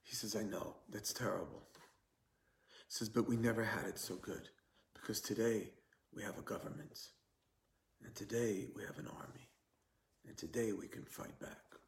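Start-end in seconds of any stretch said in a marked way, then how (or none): 0.3-1.6 hushed, imitated Polish accent; quiet Polish-accented English, retold; heavy Polish accent. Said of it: "in his heavy Polish accent". 3.1-11.8 hushed, lightly imitated Polish accent; quiet Polish-accented English, retold; heavy Polish accent. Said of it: "in his heavy Polish accent".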